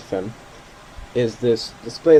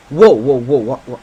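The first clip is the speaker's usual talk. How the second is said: sudden loud alarmed whoa; startled; 10 dB louder than usual. Real, not acted. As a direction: caught off guard by a jolt. Sudden jolt, gust hits plane.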